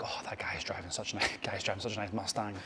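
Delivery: hushed, low, near-whispered envy; envious muttering. Acted. Scrolling social media and comparing yourself, enviously muttering. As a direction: mutter enviously under your breath.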